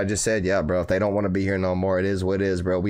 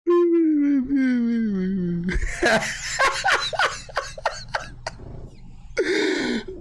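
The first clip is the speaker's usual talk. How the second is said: laugh. Real, not acted. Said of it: "laughing at own fake crying".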